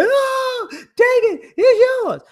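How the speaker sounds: high falsetto hammed-up moaning; mock lover's cry, high and breathless; mock sex moan. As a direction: squeal it in a silly put-on high voice. Mocking parent during sex.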